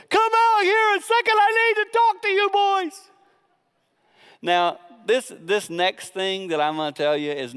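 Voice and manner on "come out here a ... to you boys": high, loud royal call; a king calling the boys out of the fire; hammed-up king summoning; Nebuchadnezzar calling. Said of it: "King Nebuchadnezzar said".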